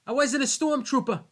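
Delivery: loud, high outraged shout; mock angry shout. Hosts quoting Kevin Smith's outburst, mock angry shout.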